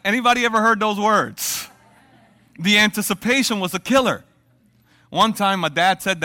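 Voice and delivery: preacher rousing the crowd; excited preaching. Acted. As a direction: call it out to the congregation. Preaching at force.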